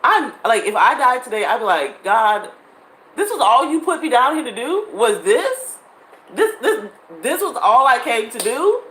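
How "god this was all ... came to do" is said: higher, louder, disbelieving complaint; a raised, incredulous plea upward; disappointed plea to God. Retold or imitated. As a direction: ask God as if cheated.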